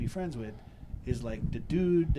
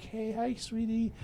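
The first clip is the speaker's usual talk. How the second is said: mild sweet talk; 8 semitones higher, slower than usual. Retold or imitated. Sweet-talking the cat.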